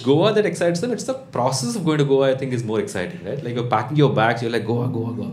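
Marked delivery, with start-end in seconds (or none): none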